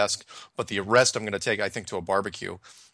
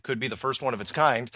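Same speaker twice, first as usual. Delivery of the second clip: normal talking voice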